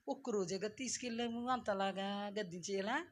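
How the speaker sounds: very soft mock complaint; quietly coaxing, in mimicry; mock gentle pleading. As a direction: imitate a meek objector, hushed. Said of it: "మెల్లగా చెప్పుకోవాలి".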